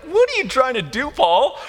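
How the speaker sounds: high-pitched baffled questioning; mock incredulous. Preacher voicing readers reacting to joy and crown, incredulous. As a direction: challenge him in high, playful disbelief.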